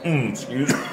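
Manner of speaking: coughing on smoke; coughing fit. Real, not acted. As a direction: choke and cough through it. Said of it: "we're choking up here".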